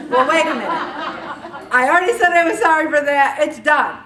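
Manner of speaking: loud, high mock indignation; hammed-up defensive outburst; exasperated protest. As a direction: protest loudly like someone wrongly accused. Acted protest at dredged-up old grievance.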